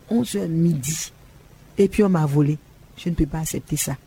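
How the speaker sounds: ordinary talk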